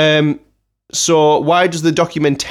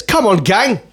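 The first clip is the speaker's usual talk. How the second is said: mock gang leader; 5 semitones higher, slower than usual. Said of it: "She looks like she says".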